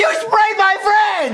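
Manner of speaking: high, slow wail; distraught shout. Acted. Boy accusing cook of spraying his friend, distraught. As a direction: cry out the accusation in anguish.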